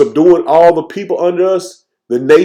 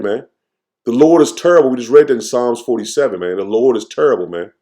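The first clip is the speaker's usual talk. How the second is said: ordinary talk, nothing marked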